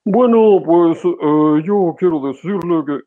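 a woman faking a man's voice, lightly; mock man voice. Shown as a demonstration. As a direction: put on a pompous fake manly voice. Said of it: "Normalmente hacen esto".

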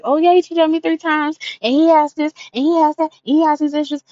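high, loud gossipy rattling-off; mock gossip. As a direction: dish the dirt like a messy gossip. Mocking someone airing business.

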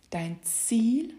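normal talking voice

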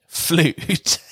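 "Flute" said "FLOOD" with a long "oo", like food. Shown as a demonstration. Host demoing the swap, flood said like food. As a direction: stretch the vowel in "Flute" to "oo". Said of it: "pronounced like food with an L".

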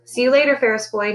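usual speaking voice

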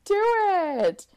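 high, loud flamingo urging; eager flamingo. Acted. Flamingo urges the lovers on, high loud voice. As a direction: squawk it out, egging them on. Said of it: "a flamingo that's like".

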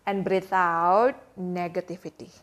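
soft, slow meditation voice; guided breathing. Acted. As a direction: breathe them into calm, slowly. Mock breathing exercise.

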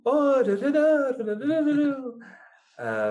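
high, loud Italian-like lilt on da da da; mock Argentine sing-song. Imitating the Argentine accent to a friend, high and loud lilt. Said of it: "como si fueran italianos la manera en la que hablan".

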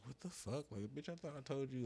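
retold exasperation. Retells exasperation at her arrival.

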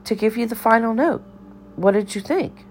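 put-on high, quick eccentric old lady voice; high-pitched dotty cafeteria lady; bird lady. A narrator's reading. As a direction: rattle it off high and fast like a batty lunch lady.

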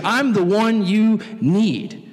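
slow, weighty promise; slow earnest claim; solemn declaration. Retold or imitated. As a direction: lay it down slowly. Jesus declaring himself.

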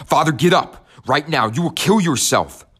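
a high, loud desperate howl. Acted. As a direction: cry out to a collapsing father. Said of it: "I howled".

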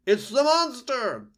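piercing high, very loud alarm; owl screech. A narrator's reading. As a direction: screech a drawn-out warning to everyone. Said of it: "fluttered up from his branch and screeched".

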